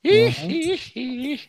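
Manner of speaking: very high falsetto "hee-hee"; playful high-pitched yelps; mock squeal. Echoing the other host's squeal.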